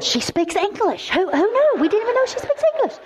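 high-pitched, hammed-up disbelief; mock astonishment. Two front-row girls discover she speaks English, mock astonishment. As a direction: imitate two girls squealing in shock.

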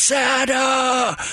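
high, drawn-out mock shout; excited child's call. Boy runs up behind Santa, retold excited shout. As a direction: imitate a boy calling out while running up. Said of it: "voice behind me coming running at me fast".

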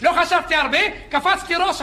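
ordinary delivery

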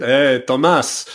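slow, hammed-up French accent, cartoon-skunk style; mock French accent, drawn out; Pepe Le Pew French accent. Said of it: "the Pepe Le Pew style accent".

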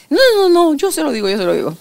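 urgent mock protest. Husband hurriedly heading off his abrupt wife, retold urgent protest. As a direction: cut in hastily to take over the job.

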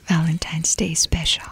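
whispered, husky and sick; sexy whisper. Pretend. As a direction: breathe it out in a whisper. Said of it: "say Valentine's Day in your sexy voice".

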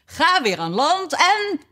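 high, drawn-out call; excited call. A narrator's reading. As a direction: call it high and slow, left hanging. Still shouting dance steps, breaks off.

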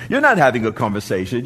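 ordinary delivery

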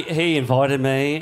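ordinary delivery

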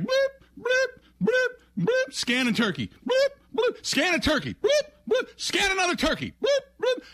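high squeaky register beeps; high bloop bloop scanner noises. Retold or imitated. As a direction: imitate a checkout scanner beeping. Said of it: "they're like".

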